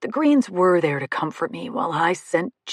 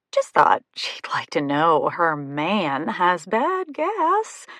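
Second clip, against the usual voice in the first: slow tease that peaks on "gas"; stress on gas; slower than usual. A narrator's reading. Taunting with emphasis on gas.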